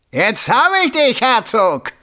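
high, loud cry of triumph; triumphant shout. A narrator's reading. Pursuer bursting in on the Duke, triumphant shout. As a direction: crow it out at full pitch. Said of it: "ein triumphierender Ruf".

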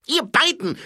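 high, loud barked order; calling out. Acted. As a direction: shout the dwarfs to attention. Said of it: "rief Feldwebel".

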